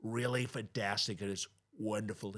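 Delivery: lightly voiced Trump; Trump impression. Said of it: "So even when I'm like".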